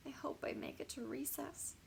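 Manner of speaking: soft anxious whisper; hushed private worry. A narrator's reading. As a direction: whisper it to yourself. Frightened pupil's private thought.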